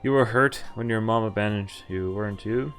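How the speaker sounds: normal talking voice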